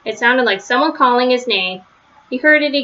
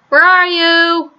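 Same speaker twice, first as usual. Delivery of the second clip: higher, louder call across the woods; 6 semitones higher, 4 dB louder, slower than usual. A narrator's reading. Father searching the path, calling loudly. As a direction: call his name into the dark. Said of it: "he called".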